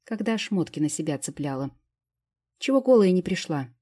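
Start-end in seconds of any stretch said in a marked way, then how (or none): 2.6-3.7 loud, higher indignant retort; indignant outburst. A narrator's reading. Fairy snapping back at Vitalik, indignant loud retort. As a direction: protest in outrage. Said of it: "возмутилась она".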